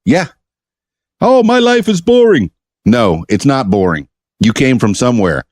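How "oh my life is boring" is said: high-pitched mock complaint; whiny objecting listener. Host mimics a listener who claims to be boring, mock whiny.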